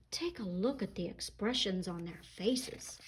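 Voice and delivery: hushed conspiratorial whisper. A narrator's reading. Storyteller pointing children to the rhinos' faces, whisper.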